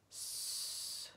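long unvoiced snake hiss. A narrator's reading. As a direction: hiss through the teeth like Gollum. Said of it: "said Gollum".

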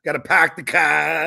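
loud put-on Boston accent; mock Boston accent with dropped R's. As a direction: call it out in broad Boston. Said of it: "I love that Boston accent".

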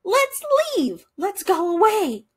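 high, bright burst of a sudden idea; excited quack. A narrator's reading. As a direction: squeal it out like a thrilled little duck. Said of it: "he quacked".

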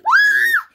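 high, loud startled scream; sudden high-pitched yelp. Pretend. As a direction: shriek out at a ghost. Screams at seeing a ghost.

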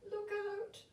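very high calling out in alarm; calling girl. Acted. Marie warning the nutcracker as the Mouse King attacks, high alarmed call. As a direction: warn him at the top of her voice. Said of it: "called Marie".

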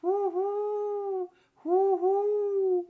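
hohes, gehaltenes Eulenheulen; unheimlicher Eulenruf. A narrator's reading. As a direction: wie eine Eule durch die Nacht heulen. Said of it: "ertönte ein unheimliches". (schauriges, lautes Huhu)

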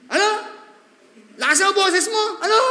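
high, loud, half-laughing scolding; irritable parent shout. Acted. Preacher voicing an aging parent scolding a child, loud and irritable. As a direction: snap back like a cranky old parent who mishears.

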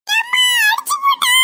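high, wailing tattle to mother; crying out. Acted. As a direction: bawl it out like a hurt child. Child hit, cries to mother. (வலியில் கதறி அழுகை)

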